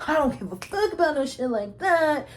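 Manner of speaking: lightly voiced homegirl, brushing it off; a hint of a sassy friend waving it away; mock carefree friend. Voicing homegirl's carefree quote.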